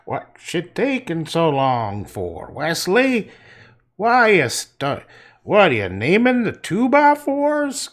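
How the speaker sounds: hammed-up impatient yelling, slow and swooping high; mock sawmill worker. Sawmill guy hurries slow teenage Wesley along, mock yelling. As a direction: badger him like a hammed-up old-time mill hand. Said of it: "yell to me when I take too long".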